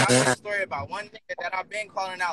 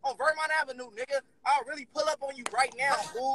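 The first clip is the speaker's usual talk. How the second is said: heated threat in a raised pitch; irate; 4 semitones higher, 4 dB quieter than usual. Real, not acted. Caller threatening to pull up on a rival, irate. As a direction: threaten angrily without adding volume. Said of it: "Why you so irate and mad at me?".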